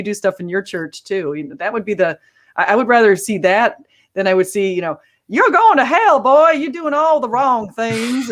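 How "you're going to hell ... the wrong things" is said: mock Southern preacher, high, loud and stretched out; heavy Southern accent. What they'd rather not see from preachers, heavy Southern accent. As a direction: scold sinners in an exaggerated Southern drawl. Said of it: "where you get the really heavy Southern".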